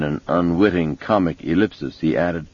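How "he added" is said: plain reading voice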